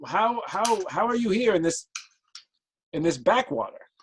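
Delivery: high, stammering disbelief; astonished. Acted. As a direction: marvel at an unexpected visitor. Game master voices astonished villager.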